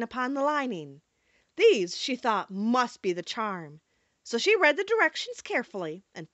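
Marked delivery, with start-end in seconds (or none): none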